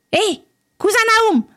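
very high, squeaky little-man call; tiny man calling. Acted. Tiny bearded man summoning his servant, high squeaky call. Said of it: "appela-t-il".

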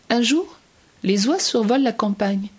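ordinary delivery

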